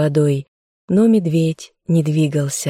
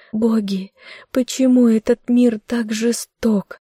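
crying; 6 semitones higher than usual. Acted. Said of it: "Уже тише, без сил плакала".

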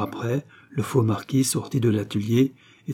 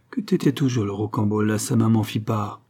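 usual speaking voice

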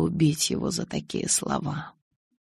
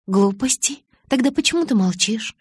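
rising, louder indignant questions; indignant disbelief; 6 semitones higher, 5 dB louder than usual. A narrator's reading. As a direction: push back in disbelief. Jealous girlfriend pressing him.

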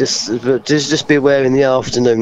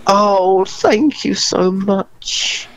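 drawn-out thanks; 6 semitones higher, much slower than usual. Retold or imitated. Retold grateful reaction to ban.

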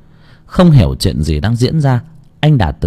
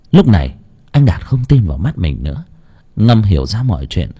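plain reading voice

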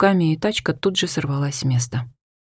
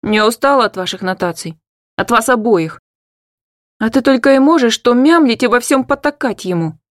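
higher, louder rising yell; shouting; 5 semitones higher, 4 dB louder than usual. Acted. Hanna shouting in her mother's face, high and loud. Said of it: "Крикнула я в лицо матери".

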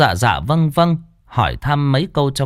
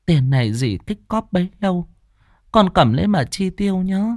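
tearful; 5 semitones higher than usual. Acted. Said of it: "quẹt nước mắt".

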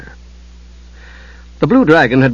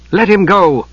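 higher-pitched woman's command; raised female voice, commanding; female voice ordering; 7 semitones higher, slower than usual. A narrator's reading. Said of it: "ordered the robed figure with a female voice".

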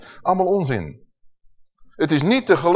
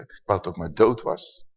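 low, subdued lament; despondent thought; 8 semitones lower, 4 dB quieter than usual. Acted. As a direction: voice a weary thought kept to oneself. Voices a despairing private thought.

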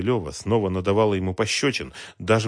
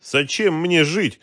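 high, slow cry of despair; 10 semitones higher, 6 dB louder, slower than usual. A narrator's reading. Woman after a failed suicide, high despairing lament. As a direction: draw the question out hopelessly. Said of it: "в отчаянии произнесла она".

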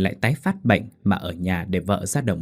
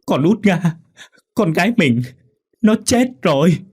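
much higher, much louder grieving howl; howling grief; 9 semitones higher, 6 dB louder than usual. A narrator's reading. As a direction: scream the news out in grief. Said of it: "gào lên". (gào lên trong đau đớn)

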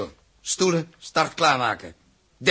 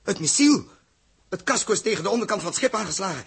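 higher, urgent, rapid orders; urgent alarm; 6 semitones higher, 4 dB louder than usual. Acted. The captain barks orders to his crew in alarm. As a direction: snap out the orders as the ship is hit. Alarm after blow to ship.

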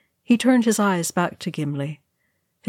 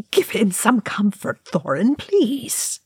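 high-pitched plea, rising at the end; begging; 5 semitones higher than usual. A narrator's reading. Oin asking Thorin to comfort Gimli, begging. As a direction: implore in a high character voice. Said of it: "Oin begged".

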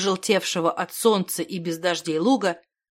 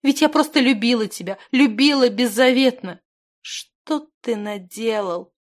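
raised, pleading voice falling into despair; anguished reproach; 5 semitones higher, 4 dB louder than usual. A narrator's reading. Heartbroken girl reproaching the man she loved, anguished.

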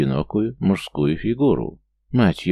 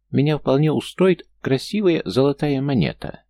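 high sly gnome; 7 semitones higher, 3 dB louder than usual. A narrator's reading. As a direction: wheedle it up high. Gnome demanding coin.

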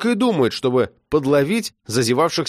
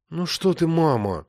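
dejected; 4 semitones higher than usual. A narrator's reading. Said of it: "уныло ответила тётя Валя".

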